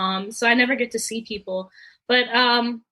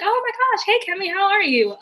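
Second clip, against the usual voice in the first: much higher, gushing hello; acting surprised and thrilled on the phone; excited greeting; 8 semitones higher than usual. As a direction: greet an old friend with delight. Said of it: "I was like".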